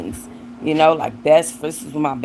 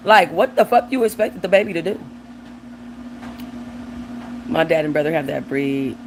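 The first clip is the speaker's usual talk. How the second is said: normal talking voice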